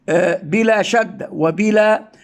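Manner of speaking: usual speaking voice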